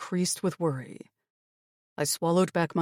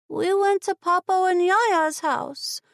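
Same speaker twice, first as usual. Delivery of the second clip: put-on high, slow small child's voice; little girl; 5 dB louder than usual. A narrator's reading. Little girl telling her aunt where they went, high child voice. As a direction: answer like a young girl. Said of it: "the girl with the shorter hair answered".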